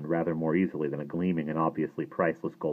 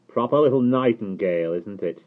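louder, higher opening that drops on the tag question; cold sneer; 4 semitones higher, 7 dB louder than usual. A narrator's reading. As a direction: sneer it coldly at the intruder. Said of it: "voice said coldly behind him".

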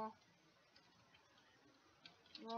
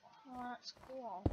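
usual speaking voice

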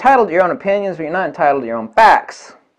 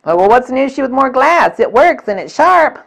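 higher, put-on voice of a doubter; mock objector; 7 semitones higher, 3 dB louder than usual. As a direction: play the smug skeptic, pitched up.

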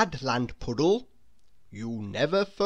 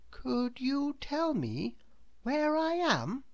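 timid question; 8 semitones higher, 6 dB quieter than usual. A narrator's reading. Mister Good timidly asking the woman where he is. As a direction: ask shyly. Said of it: "he asked".

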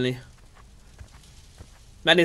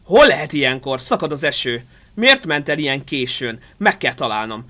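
ordinary talk, nothing marked

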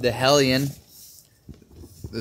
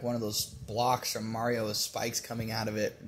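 ordinary talk, nothing marked

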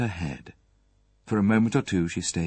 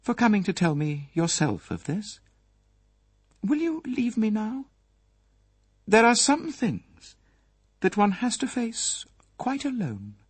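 higher, distant, measured lady's voice; formal and remote; 13 semitones higher than usual. Acted. Mrs Folliot dismissing Poirot with dignity, formal and remote. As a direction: keep him at arm's length with dignity. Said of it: "her voice was formal and remote".

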